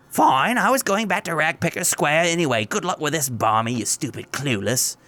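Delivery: high, huffy lady voice; dismissive woman voice. Acted. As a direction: brush them off.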